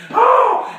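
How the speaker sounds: high, loud whoop; acted rodeo yelp; bucking-ride holler. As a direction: cry out as you're thrown about. Riding a bucking dog.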